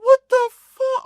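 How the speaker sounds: amazed shock. Retold or imitated. Noticing the figure wears his own chain, very high shocked squeal. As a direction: go way up in disbelief.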